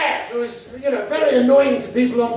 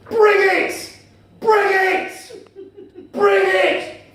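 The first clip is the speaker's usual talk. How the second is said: loud, high terrace yell; mock football shout; 9 semitones higher, 5 dB louder, much slower than usual. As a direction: bellow it like an angry fan. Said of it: "he kept shouting the same thing".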